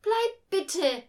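put-on high, loud girl calling out; pleading call. A narrator's reading. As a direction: shout a plea after someone leaving. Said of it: "rief sie".

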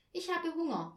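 put-on child's complaint. A narrator's reading. Barbie in the cold dark flat, child's hungry complaint.

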